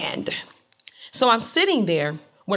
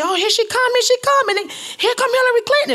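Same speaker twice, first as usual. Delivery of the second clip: very high, loud, excited cries; imitating frenzied cheering fans; screaming crowd; 6 dB louder than usual. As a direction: shriek it like a fan spotting a star. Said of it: "the screams and the cheers getting louder".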